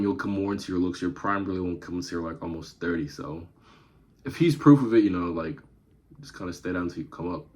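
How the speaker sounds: ordinary delivery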